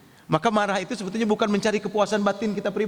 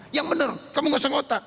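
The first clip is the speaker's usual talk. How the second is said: higher, sharp mock scolding at the child; sharp scolding disbelief; 9 semitones higher than usual. Mother doubting her child's phone excuse, sharp high scolding. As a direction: snap at the child who claims he called.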